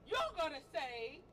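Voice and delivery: ordinary talk, nothing marked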